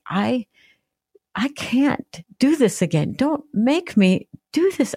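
high, slow, pleading cry that breaks upward; desperate cry to God. Retold or imitated. Woman retelling her bathroom crisis, crying out to God in desperate pleading. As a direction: beg God not to make you go through it again. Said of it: "I'm crying out to God".

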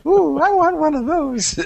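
high, slow, delighted coo; excited longing. Real, not acted. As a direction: coo like you want a toy. Gushing at Google data center photos.

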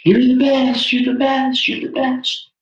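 very high, fading echo; high, fading echo of praise. Retold or imitated. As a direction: call it out, let it echo away. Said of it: "Pay attention".

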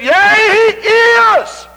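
high shouted "Yea, he is"; shouted praise. Retold or imitated. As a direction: cry it out in high joy. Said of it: "She said".

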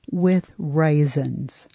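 slowly spoken title; slow and deliberate. Shown as a demonstration. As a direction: draw out the title word by word. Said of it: "let me spell some things for you".

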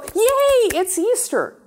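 high, joyful mock cheer; cheering Yay. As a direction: shout it like a happy kid at Easter. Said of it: "that's why we go".

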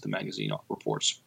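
ordinary talk

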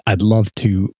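normal talking voice

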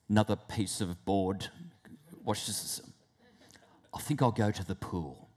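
low, soft mutter to oneself; muted backing-off. Acted. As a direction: quietly talk yourself out of it. Voices timid thought, backing off.

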